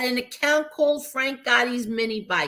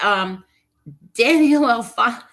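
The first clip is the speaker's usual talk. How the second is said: normal talking voice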